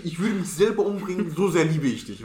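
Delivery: half-mock vow of obsessive love; aggressive love. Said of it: "das ist dieses aggressive Lieben". (leicht gespielte, leidenschaftliche Liebesbeteuerung)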